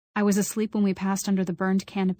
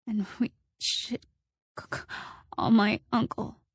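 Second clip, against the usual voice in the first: shaky, stammering over call; stuttering; 2 semitones higher, 3 dB quieter than usual. A narrator's reading. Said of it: "I was stuttering now".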